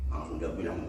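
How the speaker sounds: ordinary talk, nothing marked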